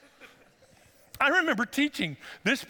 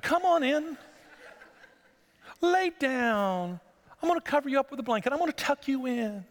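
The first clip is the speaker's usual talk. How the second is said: much higher, sweet coaxing hostess; sugary hostess voice; 8 semitones higher than usual. Acted. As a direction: lure him in like a doting mother. Jael voiced tucking him in.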